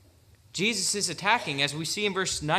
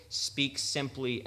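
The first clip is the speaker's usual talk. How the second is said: ordinary delivery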